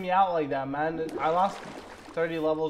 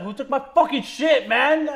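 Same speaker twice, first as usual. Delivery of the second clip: loud, higher angry yell; yelling across the room; 7 semitones higher, 4 dB louder than usual. Real, not acted. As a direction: shout it at everyone in the room. Angry over lost gear.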